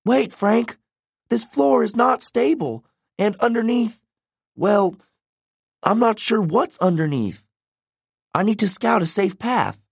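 put-on high-pitched, anxious young heroine; worried girl character. A narrator's reading. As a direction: caution a friend in a high character voice. Narrator voices worried Hazel.

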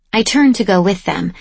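usual speaking voice

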